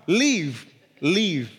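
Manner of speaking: "leave" with a long ee, each time alone; careful pronunciation. Shown as a demonstration. Preacher fixing his accent before congregation, careful pronunciation. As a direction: carefully practise "leave" as a separate word. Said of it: "for that accent".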